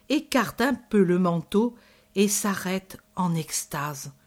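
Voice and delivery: usual speaking voice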